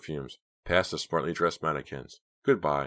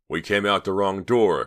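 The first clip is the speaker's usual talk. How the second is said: louder, higher, urgent call; raised voice, urgent discovery; alarmed realization; 5 semitones higher, 7 dB louder than usual. A narrator's reading. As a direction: call it out as it dawns on you. Clay's alarmed realization while fleeing.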